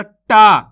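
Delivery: टा with a long, loud, drawn-out aa; teacher stretching टा out; stretched AA vowel. Shown as a demonstration. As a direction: hold the aa of टा, slowly and firmly. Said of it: "आ की मात्रा वाले अक्षर को खींच के पढ़ेंगे".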